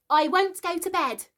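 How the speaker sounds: put-on high, loud little boy's refusal; a small boy yelling that he won't go; child shouting. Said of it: "he had shouted".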